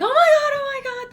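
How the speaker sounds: imitating delighted strangers, very high; high squealed gushing, starting loud; gushing taxi drivers. Mom retelling taxi drivers loving her son's Korean, gushing squeal. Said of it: "they would be so like".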